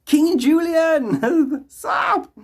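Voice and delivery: high, loud, drawn-out royal lemur call; King Julian voice. Acted. Hailing the chatter as a cartoon king. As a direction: announce him like a cartoon king.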